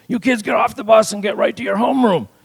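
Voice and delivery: high, loud bus driver order; old-time bus driver. Acted. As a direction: holler at the kids like a school bus driver. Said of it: "that's how bus drivers talk back then".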